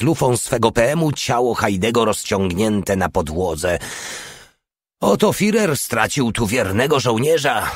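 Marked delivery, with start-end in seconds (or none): none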